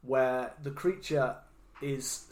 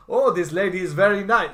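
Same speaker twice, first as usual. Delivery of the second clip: high, loud hammed-up Russian accent; mock Russian accent; 6 semitones higher, 11 dB louder than usual. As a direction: play a cartoonish Russian accent. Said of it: "does the Russian accent".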